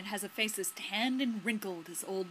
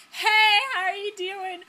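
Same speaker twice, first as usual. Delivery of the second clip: loud, high hail out of nowhere; mock high-pitched greeting; imitated mystery voice; 10 semitones higher, 10 dB louder than usual. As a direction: call out cheerily like an unseen stranger. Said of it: "And this voice goes".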